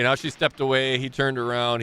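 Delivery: usual speaking voice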